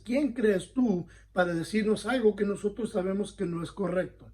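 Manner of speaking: ordinary talk, nothing marked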